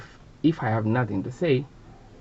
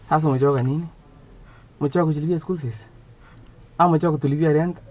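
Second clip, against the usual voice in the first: mother's voice, pitched up a little and climbing; scolding mother; 4 semitones higher than usual. Acted. Mother to her tired child, scolding mother voice. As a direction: play Mum firing off pointed questions. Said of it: "My mother would say".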